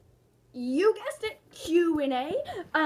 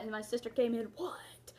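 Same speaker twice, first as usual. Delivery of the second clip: hushed whispered retelling; whispering; 4 semitones lower, 8 dB quieter than usual. Retold or imitated. Retelling how the sister came in at night, whispered. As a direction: breathe it out unvoiced.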